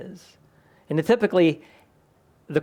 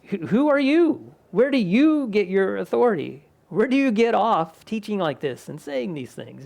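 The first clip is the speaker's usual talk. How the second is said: indignant religious leaders; 6 semitones higher than usual. Retold or imitated. Preacher voicing religious leaders' indignant challenge. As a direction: demand it like an offended elder. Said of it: "the religious leaders were like".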